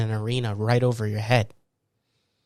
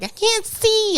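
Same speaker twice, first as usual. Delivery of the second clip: put-on squeaky, much higher child voice; mock child complaint, pitched far up; kid whining; slower than usual. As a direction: play a blinded kid whining. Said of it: "the kid's like".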